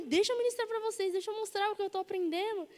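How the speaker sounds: high, insistent begging; re-enacted pleading. Learner asking friends to let her practise preaching, pleading. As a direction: plead with them, pitched well up.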